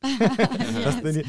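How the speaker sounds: slow, high, laughing through words; giggling at her own phrase. Real, not acted.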